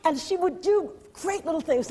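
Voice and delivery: normal talking voice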